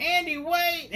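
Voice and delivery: high, loud cry of a doll begging; mock Chucky pleading at gunpoint; panicked shout. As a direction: yell out to stop him, high-pitched. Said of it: "And he's like".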